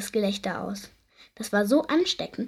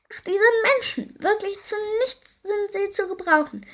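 very high shrieking goblin voice; shrieking creature; 11 semitones higher than usual. A narrator's reading. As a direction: shriek it in disgust. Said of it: "kreischte die Stimme". (hohes, kreischendes Wesen)

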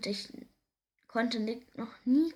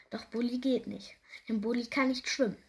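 ordinary delivery, nothing marked

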